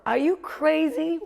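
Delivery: high, loud, drawn-out scolding. Acted. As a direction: berate yourself like a panicked inner voice. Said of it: "that voice got louder".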